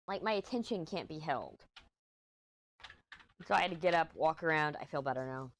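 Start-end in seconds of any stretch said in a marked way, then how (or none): none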